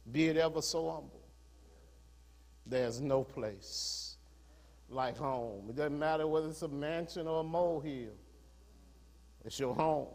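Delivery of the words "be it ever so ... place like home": a hint of a Jamaican accent. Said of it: "in her Jamaican accent".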